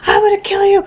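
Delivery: high, loud raging threats; mock furious scream. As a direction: yell it like the unhinged driver. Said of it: "He's like".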